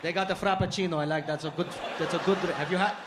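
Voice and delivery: put-on Arabic-accented English, musing approval; cousin's Arabic-accented enthusiasm. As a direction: praise it in a thick Arabic accent. Cousin keeps praising American chains.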